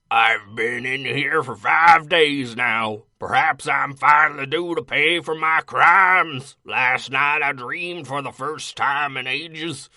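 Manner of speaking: loud, slow, strained dramatic read. Acted. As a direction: push the voice hard, reading slowly. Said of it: "That hurts my voice".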